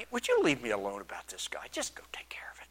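fed-up whisper; weary whisper. Retold or imitated. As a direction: whisper it, sick of the whole affair. Pilate waving off the priest.

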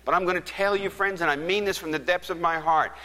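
high-pitched, forceful sermon delivery; impassioned preaching. Real, not acted. Preacher urges congregation to church, impassioned and high. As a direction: press the appeal home with mounting force.

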